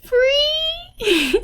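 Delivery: put-on high, bright little-girl voice; Yotsuba's high child voice. Teacher voicing the child's happy shout. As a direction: chirp it like a small excited child.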